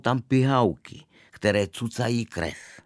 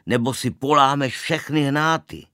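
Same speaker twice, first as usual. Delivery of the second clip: loud, higher warning yell; storyteller's acted bellow; narrated yell; 5 semitones higher, 5 dB louder than usual. A narrator's reading. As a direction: yell a threat of what will happen. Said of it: "zařval jsem".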